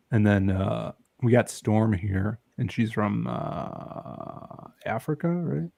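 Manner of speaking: ordinary talk, nothing marked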